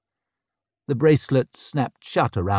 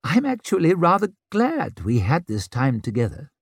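disbelieving grin; 6 semitones higher than usual. A narrator's reading. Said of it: "he gave a disbelieving grin".

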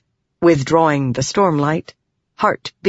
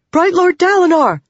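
urgent shout; 14 semitones higher, 5 dB louder than usual. Acted. Messenger calls out urgently.

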